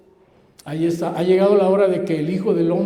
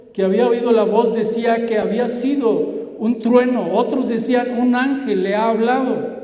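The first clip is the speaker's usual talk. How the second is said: raised, declaimed scripture reading; preaching; 4 semitones higher, 5 dB louder than usual. Real, not acted. Preacher reading of a voice from heaven, declaimed preaching. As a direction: proclaim the verses out to the room. Preacher recites the gospel passage.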